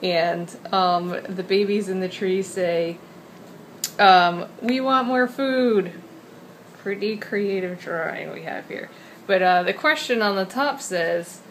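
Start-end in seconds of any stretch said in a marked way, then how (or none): 4.6-5.9 high, slow whiny chick voice; baby birds voice. Pretend. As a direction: whine it like hungry baby birds.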